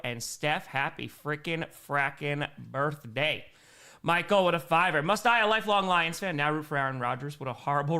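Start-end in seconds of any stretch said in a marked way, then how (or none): 0.6-3.4 slow, spaced-out "happy frickin' frackin' birthday"; deliberate, drawn-out delivery; slow birthday wish. Real, not acted. Second birthday wish, stretched out.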